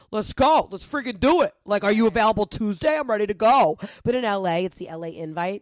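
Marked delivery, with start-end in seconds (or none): 0.1-3.8 pushy New Yorkers. Retold or imitated. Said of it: "people are like".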